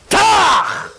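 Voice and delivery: loud high shouted tach; sharp jab sound. Acted. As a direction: hit the impact noise hard. Third elbow jab.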